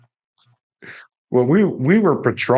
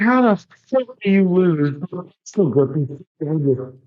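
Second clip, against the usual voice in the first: lightly hammed-up exasperated buddy; incredulous rant; 7 semitones higher than usual. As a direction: draw it out like you can't believe the idiot. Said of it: "I was like".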